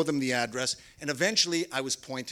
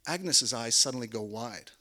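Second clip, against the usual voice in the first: ordinary talk, nothing marked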